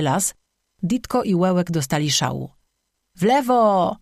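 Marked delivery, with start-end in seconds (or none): 3.2-4.0 high, drawn-out howl; higher-pitched creature's wail. A narrator's reading. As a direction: howl out the direction like a frantic creature. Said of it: "wył jeden".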